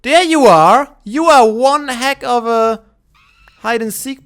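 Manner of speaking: loud, high delighted call; excited shout. Real, not acted. Finds hidden mammoth.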